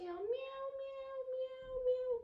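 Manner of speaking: high playful meows; squeaky pretend cat meows; kitty meows. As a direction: meow like a little kitten. Cat pose again.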